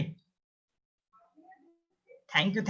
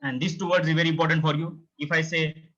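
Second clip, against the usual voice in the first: usual speaking voice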